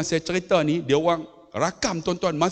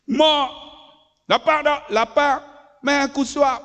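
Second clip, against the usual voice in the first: retold mother and child; 7 semitones higher than usual.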